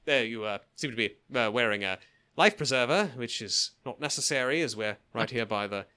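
a light stammering Hugh Grant; Hugh Grant impression. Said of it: "let me get my Hugh Grant together".

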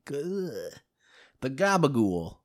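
drawn-out, playful Italian-American voice; slow put-on Italian accent, savoring the words; mock Italian accent. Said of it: "You look a little Italian".

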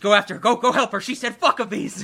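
loud, urgent mock panic; mock alarm. Host mimics alarmed staff sending someone after her, mock alarm. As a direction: raise the alarm, hammed up.

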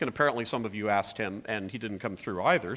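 normal talking voice

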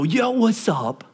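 high, drawn-out mock-casual hail; flippant greeting. Director showing how not to address God, mock flippant drawl. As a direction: imitate someone far too chummy with God. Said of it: "we wanna flippantly talk to".

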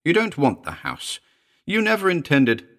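high-pitched, anxious woman; high querulous dread. A narrator's reading. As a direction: accuse him in mounting dread. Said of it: "rose high and querulous with a nameless dread".

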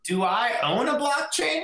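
put-on high old lady voice; confused grandma asking. Grandma voice continues.

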